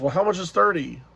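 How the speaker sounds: the stranger asks the price again, insistent; customer asking again. Retold or imitated. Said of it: "And he goes".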